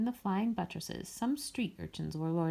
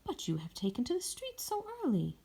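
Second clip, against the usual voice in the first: Mireille's voice; 8 semitones higher than usual. A narrator's reading.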